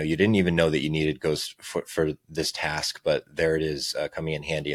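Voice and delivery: ordinary delivery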